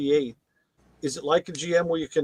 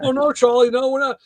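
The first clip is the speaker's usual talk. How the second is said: high, loud, hasty denial; mock afraid; 7 semitones higher, 6 dB louder than usual. Crew answering the feared maintenance guy, mock afraid. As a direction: back down as if scared of him. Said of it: "'Cause everybody's afraid of Charlie".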